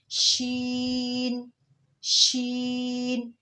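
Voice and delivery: "शीन" with the sh sound spread wide, very slow; spreading sheen. Shown as a demonstration. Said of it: "इसमें आवाज़ फैल जाती है". ("शीन" फैलती आवाज़ में)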